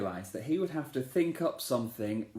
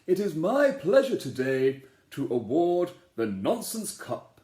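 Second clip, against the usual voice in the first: louder kingly address; king's speech; 2 semitones higher, 6 dB louder than usual. Acted. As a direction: proclaim it to the crowd. King addresses the crowd.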